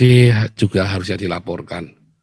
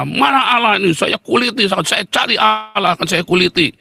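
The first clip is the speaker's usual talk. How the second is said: high-pitched imitated tirade; angry ranting; 10 semitones higher than usual. Imitating the Batak man raging at Allah, angry. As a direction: mimic a man raging in search of God. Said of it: "anak Batak itu marah-marah".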